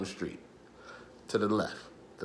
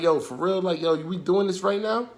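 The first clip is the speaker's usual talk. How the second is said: squaring up; 7 semitones higher than usual. Retold or imitated. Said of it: "and I was like".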